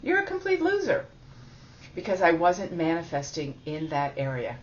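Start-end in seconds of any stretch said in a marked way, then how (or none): 0.0-1.1 mocking inner voice. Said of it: "this part was coming up to say".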